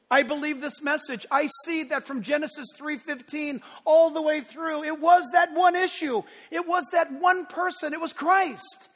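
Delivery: high, loud, near-shouted proclamation; fervent preaching. Acted. Pastor voicing a believer's prayer, fervent shouting. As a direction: build to a full shout of faith.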